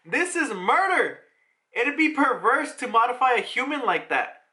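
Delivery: high, indignant acted protest; pissed off. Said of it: "he's just pissed off".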